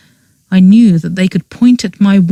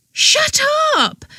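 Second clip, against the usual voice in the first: high, sharp shriek; high piercing cry of denial; 5 dB quieter than usual. A narrator's reading. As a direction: shriek it at him, pitched way up. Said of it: "Petra shrieked".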